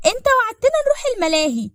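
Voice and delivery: put-on very high, loud kid voice; insistent child. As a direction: pipe up like a demanding child. Kind erinnert Papa ans Versprechen.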